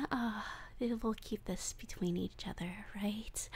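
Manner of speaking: very soft hushed whisper; secretive whisper. Acted. Captor whispers a secret to captive, very soft. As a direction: keep it your little secret.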